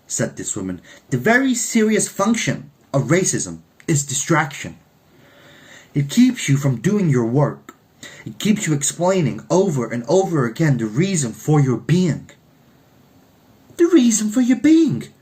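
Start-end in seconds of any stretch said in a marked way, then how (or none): 1.1-12.3 lightly voiced older American woman, serious; stern American woman speaker. 13.8-15.1 much higher, louder astonished repeat; startled inner echo. Acted. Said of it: "thought Olivia".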